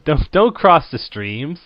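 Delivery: loud and slow warning; shouting. Acted. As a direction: bellow the warning. Ghostbusters quote shouted.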